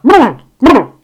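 loud high "brr, brr" shiver sound; shudder noise. Retold or imitated. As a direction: shake it off like strong coffee. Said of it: "And you're like".

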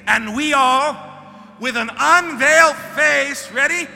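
high, loud preacher's cry; full-force preaching. Acted. Preacher urging the congregation to eat the word, shouting. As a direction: hit it like a revival climax. Leads reading over music.

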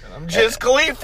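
high, loud put-on voice; hammed-up co-host. Co-host introducing himself, high goofy voice. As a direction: announce yourself like a goofy sidekick.

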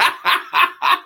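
high, loud airy laugh; real laughter; breathy laugh. Real, not acted. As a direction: burst out laughing. Bursts out laughing.

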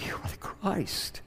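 breathless whisper; hushed awed whisper; whispered confession. Acted. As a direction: breathe it out in awe.

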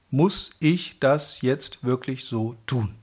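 slow recital, word by word; measured test sentence for careful listening; slow, measured reading. Shown as a demonstration. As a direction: say it slowly, each word set apart. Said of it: "einfach mal langsam".

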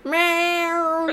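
high cat-like meow. Pretend. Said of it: "I thought that would work".

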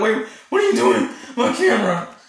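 high, loud alarmed cry, imitating; mock panicked protest. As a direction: call out in hammed-up alarm. Acts out abandoned man's panicked protest.

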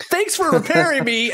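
high, gleeful mock thank-you; cheery cartoon thanks. Tank driver thanking his repairer, cheery and high-pitched. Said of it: "with the biggest smile on his face".

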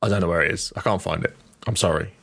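ordinary talk, nothing marked

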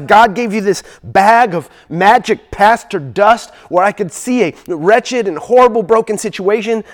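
loud, high, driving sermon voice; full-force preaching. Acted. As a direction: preach it out to the whole room with fervor.

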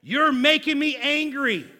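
family member venting at preacher, mock angry. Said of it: "when they're venting and they're saying".

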